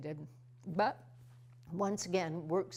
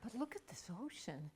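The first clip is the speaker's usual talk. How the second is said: whisper; 3 semitones higher, 10 dB quieter than usual. Real, not acted. Consoling herself after heartbreak.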